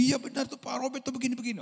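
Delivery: a wife stoking her husband's anger; egging-on wife. Pretend. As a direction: egg him on eagerly. Imagined wife fueling anger.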